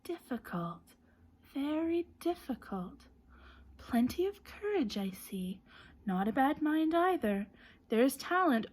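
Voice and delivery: lightly voiced thoughtful hat, musing; a hint of a pondering magic hat; small voice. A narrator's reading. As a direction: ponder aloud close in his ear. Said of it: "said a small voice in his ear".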